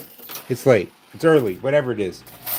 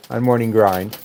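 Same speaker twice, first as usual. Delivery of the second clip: normal talking voice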